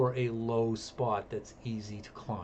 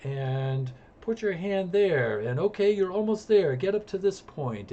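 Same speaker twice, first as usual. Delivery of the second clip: calling up instructions; 6 semitones higher than usual. Retold or imitated. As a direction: shout guidance to a nervous climber. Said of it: "I'm instructing her".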